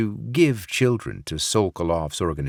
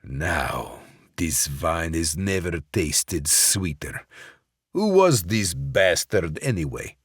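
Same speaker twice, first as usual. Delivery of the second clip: gloating Russian boss. Acted. Sokolov gloating over Barrett's body. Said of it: "Sokolov laughed at Barrett's demise".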